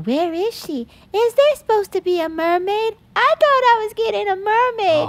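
very high little-kid imitation; whining kids. Kids at the party waiting for the mermaid, childish whine. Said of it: "you can hear all the kids going".

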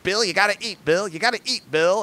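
high-pitched imitation of an insistent grandma; mock grandma pestering her husband; nagging grandma. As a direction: plead and nag like a fussing grandmother. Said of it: "She's like, she's like".

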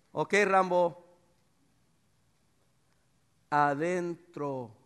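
slow, loud, drawn-out order; loud, slow command to come inside; firm command. Retold or imitated. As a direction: stretch every word so the dog obeys.

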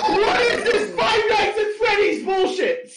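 high, cracking up while reading; can't get through it for laughing; laughing disbelief. Real, not acted. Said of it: "what the fuck is this".

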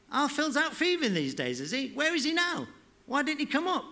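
high-pitched cheeky schoolgirl; teenage girl. Acted. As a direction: voice the girl high and quick with questions. Tracy's girl voice in dialogue.

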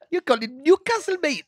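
high, loud mock Geordie accent; hammy Newcastle accent. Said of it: "Is that Alan, Alan Shearer?".

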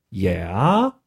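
low, drawn-out questioning yeah; puzzled prompting. Retold or imitated. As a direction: imitate baffled listeners, starting low and swooping up. Players waiting for the point.

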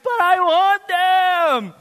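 put-on high, loud, slow whining child; whiny child. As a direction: drag it out like a sulking kid. Kid whining about brushing teeth.

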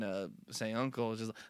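ordinary talk, nothing marked

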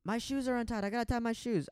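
a light take on the friend, distracted; imitating Jaden. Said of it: "Jaden's like".

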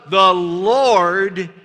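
very slow, loud, every word drawn out; drawn-out congregational chant; slow call and response. Shown as a demonstration. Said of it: "Could you say that with me?".

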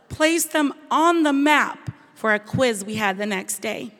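ordinary delivery

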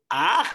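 loud imitated "aj"; short, loud mimicked cry; mock wife's scream. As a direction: throw her yelp back at her. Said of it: "mi esposa grita".

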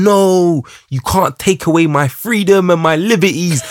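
put-on high, melodramatic kid protesting; dramatic child voice. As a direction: play the outraged little boy, hammed up. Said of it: "That's what he's really saying".

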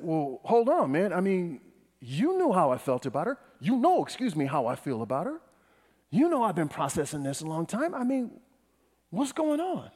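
worked-up protest, pitch leaping up; indignant protest. Retold or imitated. Preacher re-enacts confronting a man about her, indignant. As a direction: push back in rising disbelief. Retelling his own heated confrontation.

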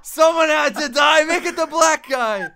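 loud, high laughing yell; laughing shout. Retold or imitated.

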